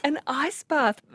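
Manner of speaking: slow, high disbelieving question; amazed, drawn-out question; surprised. Real, not acted. Host hears of ice bath.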